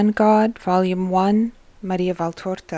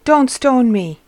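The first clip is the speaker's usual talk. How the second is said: higher, louder plea; crying out; 5 semitones higher, 6 dB louder than usual. A narrator's reading. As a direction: cry out for mercy. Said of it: "cries the man".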